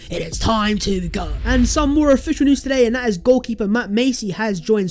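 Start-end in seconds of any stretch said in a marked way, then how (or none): none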